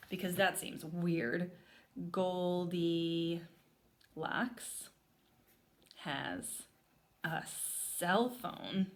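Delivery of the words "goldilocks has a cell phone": slow, drawn-out words as they're written; slow dictation. Shown as a demonstration. Teacher writes a note in the Venn diagram, slow dictation. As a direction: say it slowly while writing. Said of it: "I'm gonna add".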